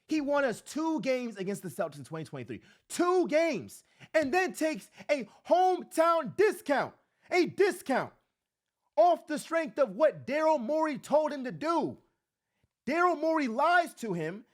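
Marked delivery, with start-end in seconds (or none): 2.9-14.4 loud, high, indignant shouting; heated rant. Real, not acted. Fan ranting to viewers about the team, loud and high. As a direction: hammer every word like an outrage.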